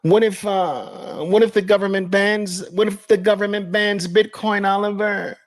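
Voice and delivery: very high, put-on whimpering worry; mock tearful whine, high throughout; crying naysayer voice. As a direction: fret like a crybaby doubter. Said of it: "Do the crying voice".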